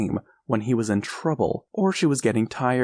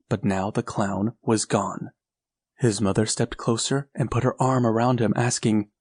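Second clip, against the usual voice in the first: plain reading voice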